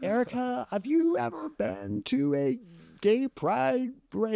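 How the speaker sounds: high-pitched incredulous question to an innocent friend; incredulous quote. Retold or imitated. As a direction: act amazed they'd even ask. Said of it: "I'm like, I'm like".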